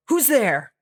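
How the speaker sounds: a hint of a small, timid character. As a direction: call out in a little voice. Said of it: "you hear a small voice go".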